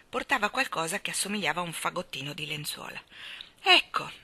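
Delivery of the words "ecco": high, loud burst; breathless exclamation. A narrator's reading. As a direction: exclaim it excitedly. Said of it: "esclamò la signora Brill senza respiro".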